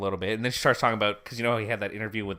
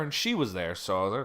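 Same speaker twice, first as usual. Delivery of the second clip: usual speaking voice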